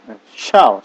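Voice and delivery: ordinary delivery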